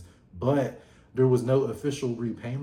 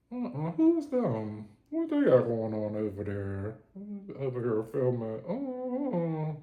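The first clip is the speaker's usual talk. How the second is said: sister's sassy quote; 2 semitones higher than usual. Retold or imitated. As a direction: imitate the sister squinting at the camera crew. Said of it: "She said".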